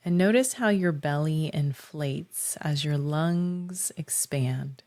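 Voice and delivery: unhurried visualization with long pauses; measured meditation voice, lingering on key words; guided meditation. Acted. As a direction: guide listeners gently inward, phrase by phrase.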